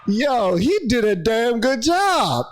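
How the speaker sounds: high-pitched, drawn-out amazement; delighted praise. Real, not acted. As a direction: cheer the comedian on. Delighted reaction to the impression.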